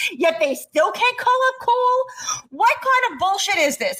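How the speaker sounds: high, slow exasperated outburst; fed-up fan ranting in disbelief; really angry. Real, not acted. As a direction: let the anger at the coaches boil over. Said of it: "'cause I'm really angry".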